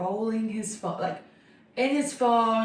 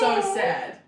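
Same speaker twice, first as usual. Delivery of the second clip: mocking pity; 12 semitones higher, 3 dB louder than usual. Mocking his reply.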